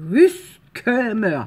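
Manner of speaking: put-on slow, drawn-out German accent, loud. Vlogger trying a German word on a plaque, slow German accent. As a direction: sound it out slowly in a mock German accent. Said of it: "ça se prononce avec un accent allemand".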